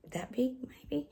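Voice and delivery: hushed, breathy guess; whispered aside. Real, not acted. Vlogger to viewers describing the implant, whispered. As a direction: whisper the size estimate. Showing size of device.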